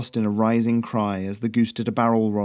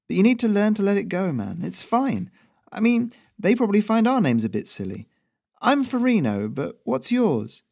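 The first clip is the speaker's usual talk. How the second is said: higher, easygoing character voice for the courier; breezy courier voice; 11 semitones higher than usual. A narrator's reading. Courier smoothing things over with the new local, higher breezy voice. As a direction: raise the pitch and play it chummy and casual.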